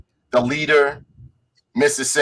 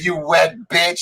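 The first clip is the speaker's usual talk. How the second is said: higher-pitched imitated whine; mock crying; 6 semitones higher, slower than usual. Crying villain insulting Iron Man, mock tearful whine. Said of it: "modok is back out there crying".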